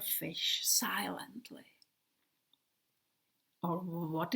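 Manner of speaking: breathy whisper on the silent glide; hushed whisper, slow. A narrator's reading. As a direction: let it drift away in a whisper. Describes plane floating silently.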